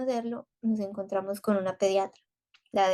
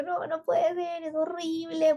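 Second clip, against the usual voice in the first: high, drawn-out retold wail; imitating herself lamenting through tears; re-enacted tearful lament; 7 semitones higher, slower than usual. Mother recalling the decorated empty nursery, re-enacted tearful lament. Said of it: "viendo ese cuarto así llorando".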